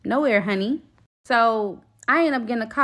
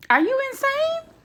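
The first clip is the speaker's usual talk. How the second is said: high, squeaky in outraged disbelief. Real, not acted. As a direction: shriek the question in disbelief. Outraged at girlfriend's coffee plan.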